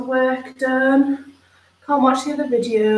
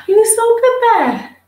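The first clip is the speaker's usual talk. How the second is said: high, loud praise for the dog; excited praise; 11 semitones higher, 6 dB louder than usual. Real, not acted. Owner praising her dog after the trim, high and excited.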